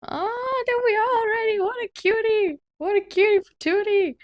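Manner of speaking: very high, loud gushing squeal over a cute character; excited squeal. Real, not acted. Player gushing at her cute new character, high excited squeal. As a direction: coo like you just saw a puppy.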